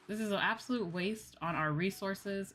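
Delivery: normal talking voice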